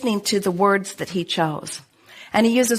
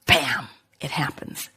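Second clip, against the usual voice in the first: softer, lower, slow whisper; 6 semitones lower, 7 dB quieter, slower than usual. Acted. Teacher revealing the moment it arrives, slow whisper. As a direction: breathe it out like a secret. Climax of the explanation.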